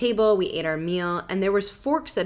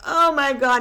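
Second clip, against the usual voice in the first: higher, louder mock horror; retold dismay; 8 semitones higher, 6 dB louder than usual. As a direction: exclaim as if just splashed with muck. Retold dismay at being splashed.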